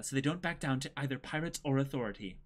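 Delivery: ordinary delivery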